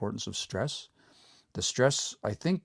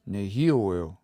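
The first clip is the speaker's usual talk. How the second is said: nehioeo, stressed on the second syllable, hi; stress moved over one; 2 semitones lower, 3 dB louder than usual. Shown as a demonstration. Teacher models nehioeo for learners, stress moved onto hi. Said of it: "So that stress moves over one".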